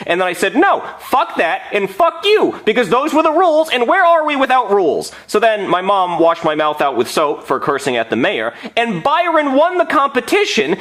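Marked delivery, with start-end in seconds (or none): 0.5-5.1 mock outraged rant. Kid curses at the mayor.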